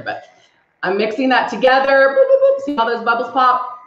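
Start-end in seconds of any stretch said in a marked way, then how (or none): 2.1-2.6 1 playful popping noises. Acted. Bubbles popping while mixing.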